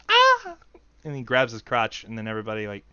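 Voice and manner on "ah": high, loud pop-star squeal. Acted. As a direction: hit a sharp falsetto yelp.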